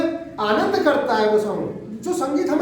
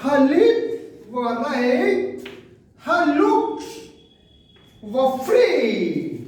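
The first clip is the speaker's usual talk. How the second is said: very slow, leaning on lips and look; sing-song metrical recitation, lips held long; teacher chanting the line to show its meter; rhythmic stressed reading; much slower than usual. Shown as a demonstration. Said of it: "ध्यान दिया?".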